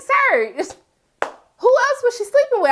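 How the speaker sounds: high, disbelieving "Sir?"; high-pitched side-eye question; incredulous sass. Retold or imitated. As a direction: call him out in disbelief. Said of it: "I'm like".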